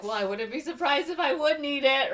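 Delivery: high, acted playing along; put-on rich lady. She answers about the chauffeur's car, put-on rich lady.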